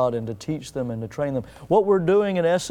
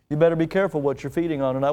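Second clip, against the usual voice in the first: ordinary talk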